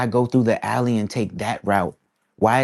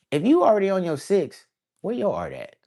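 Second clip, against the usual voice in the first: mocking retort; 10 semitones higher than usual. Mocking someone's sixth eye claim.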